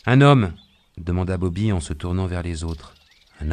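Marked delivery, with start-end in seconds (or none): 0.1-0.5 loud, high call for a volunteer. Acted. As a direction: call across to the others for help. Bobby calls for a helper. (appel lancé à voix forte)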